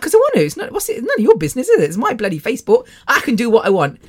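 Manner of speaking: high-pitched indignant comeback; cheeky, hammed-up defiance; mock defiant retort. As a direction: play up the protest, voice leaping high. Acts out retort to sisters.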